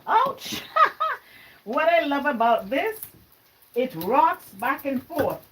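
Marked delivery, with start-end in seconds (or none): 0.1-0.6 sharp high yelp, half laughing; a quick startled ouch that tips into a laugh; sudden ouch. Real, not acted. Cuts herself on garlic press. 0.7-1.2 high burst of embarrassed laughter; laughing it off. Real, not acted. Laughs after hurting herself.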